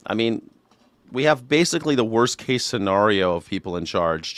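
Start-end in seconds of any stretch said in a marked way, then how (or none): none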